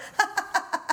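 high, breathy laugh of disbelief; exasperated mock laughter; acted-out disbelieving laugh. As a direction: imitate laughing at an absurd situation. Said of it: "I just started laughing".

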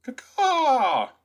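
high, loud cawing bird call; chirping bird person. Pretend. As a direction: caw like a hawk, full voice. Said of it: "That's the best bird that I can do".

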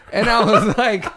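ordinary delivery

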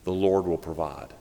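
low, soft, unhurried reassurance; calm reassurance. Acted. Older minister to nervous young preacher, calm reassurance. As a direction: quietly settle someone's nerves.

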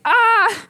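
high, loud "ah!"; mock cry of alarm. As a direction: blurt a sudden high-pitched cry. Acting out Peter's messing up.

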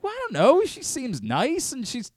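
high, sheepish justification; defensive. Retold or imitated. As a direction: plead your case sheepishly. Defending the score to wife.

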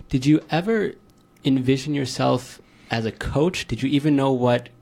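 normal talking voice

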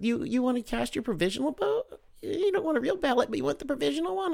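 high-pitched mimicry of a woman; mock her questions in a high falsetto; high, incredulous poll worker impression; imitated poll worker. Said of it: "She's like".

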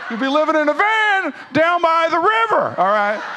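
high, loud, over-the-top comic holler; planning revival voice. Acted. Preacher strays into a comic van rant, planning revival voice. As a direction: shout it in a silly high put-on voice. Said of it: "Don't know why I'm talking like that".

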